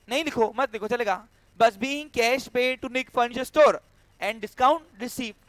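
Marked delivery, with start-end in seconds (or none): 1.8-5.4 slow, slightly raised dictation voice. Real, not acted. Teacher dictating the corrected entry to students, slow dictation. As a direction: read it out slowly for writing down.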